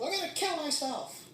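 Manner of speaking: high, drawn-out mock wail; crying wolf. Mocking people who cry wolf, high exaggerated wail. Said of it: "You know how people cry wolf".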